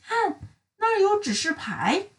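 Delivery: high, eager little voice; eager pointing out. Acted. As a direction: point it out excitedly. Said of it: "古怪小姐指着一个石头的路标说".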